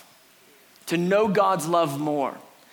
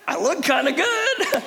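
high, amused mock boast; giggly, pitched-up acting; laughing self-satisfaction; 11 semitones higher, 4 dB louder than usual. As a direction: play pleased with yourself through a laugh. Replays the contented guy, laughing.

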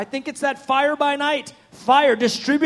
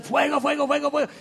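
mock yelling; faster than usual. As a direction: imitate people yelling over and over, held back. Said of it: "praying, praying, yelling".

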